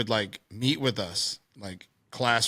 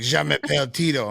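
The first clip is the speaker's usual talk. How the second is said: loud, higher mock French Canadian accent; playful French Canadian send-up, raised voice; 4 semitones higher, 5 dB louder than usual. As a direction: tease with an over-keen Quebec French accent. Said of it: "I love making fun of French Canadians".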